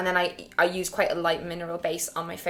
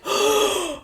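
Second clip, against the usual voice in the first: sharp high shocked gasp; 14 semitones higher, 4 dB louder than usual. Retold or imitated. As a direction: gasp in disbelief. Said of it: "you were literally like".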